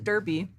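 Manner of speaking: "Derby" with an er sound; own DUR-bee pronunciation. Shown as a demonstration. Host gives own way of saying "Derby", DUR-bee. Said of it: "I would have definitely pronounced it".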